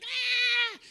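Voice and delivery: high, loud crying "ah"; mock scream of a hurt little kid; imitated child's wail. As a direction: wail like a run-over toddler. Imitates the run-over child crying.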